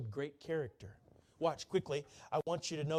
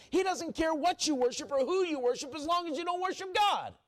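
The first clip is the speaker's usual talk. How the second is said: much higher and louder, driving hard; full-force preaching; 11 semitones higher, 5 dB louder than usual. Acted. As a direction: preach it at the top of your voice.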